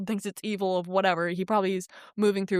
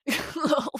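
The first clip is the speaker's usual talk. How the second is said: incredulous high laugh; 7 semitones higher than usual. Real, not acted. Laughing at how absurd it was.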